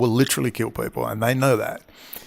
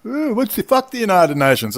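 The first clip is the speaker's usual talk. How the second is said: a higher, hammed-up voice mocking Americans, stumbling for words; mocking dumb Americans; 7 semitones higher than usual. Mocking the dumb Americans to his viewers, higher, hammed-up. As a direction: sneer it like a clueless American blurting out. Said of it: "the dumb Americans just said".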